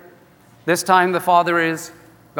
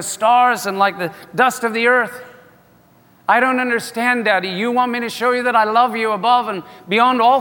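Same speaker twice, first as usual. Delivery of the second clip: pleading with his father God, pitched up; Abraham pleading; 6 semitones higher than usual. Acted. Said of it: "he is basically saying".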